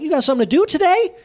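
high, rising incredulous query; mock baffled questioning. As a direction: act out puzzled prying. Retold probing questions to upset friend.

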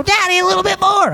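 put-on high-pitched child's whine; pleading kid. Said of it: "turn to Daddy and go".

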